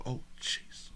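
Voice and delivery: breathy whisper under the breath. Real, not acted. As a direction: whisper it in disbelief. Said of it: "whispered reaction".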